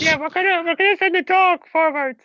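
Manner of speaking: high pantomime voice; broad singsong kids theatre. Acted. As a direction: ham it up like a panto actor. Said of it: "'cause I sound like the pantomime".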